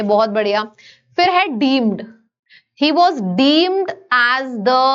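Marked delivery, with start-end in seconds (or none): 2.8-4.8 slow dictation. Shown as a demonstration. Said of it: "reads example sentence slowly".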